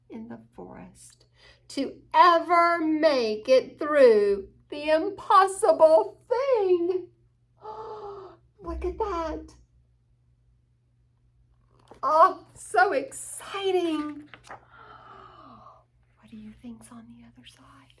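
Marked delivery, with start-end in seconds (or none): 1.7-7.1 rising excitement. A narrator's reading. Climax of the story. 7.6-8.3 sharp soft gasp of wonder; quiet gasp at the view from the top; awed gasp. Pretend. Reader reacts to view. 8.6-14.3 excited. Pretend. Reader marvels at the view. 16.2-17.9 fast secretive whisper; fast hushed whisper to the young listeners. Pretend. Reader asks children conspiratorially.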